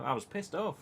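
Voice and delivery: usual speaking voice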